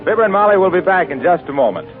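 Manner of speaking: usual speaking voice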